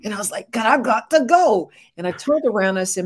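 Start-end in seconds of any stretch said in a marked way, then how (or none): 0.5-1.7 urgent protest. Retold or imitated. Retelling rushed protest to God.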